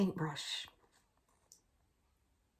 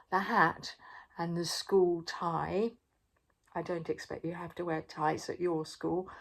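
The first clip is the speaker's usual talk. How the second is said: ordinary delivery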